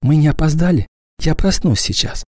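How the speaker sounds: put-on drowsy child, barely awake; sleepy child whisper. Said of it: "сонно прошептал".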